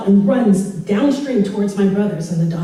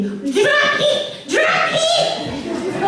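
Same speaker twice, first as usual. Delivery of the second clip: much higher shrieked command, laughing; mom screaming; much slower than usual. Retold or imitated. Mom hanging out of the car yelling at her daughter, high shriek. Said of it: "she's screaming".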